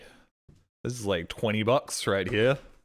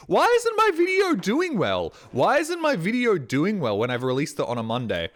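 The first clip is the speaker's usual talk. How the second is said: mock whining; 9 semitones higher, 6 dB louder than usual. Vlogger parodying himself whining about views in a high loud voice. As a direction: whine like a sulking creator.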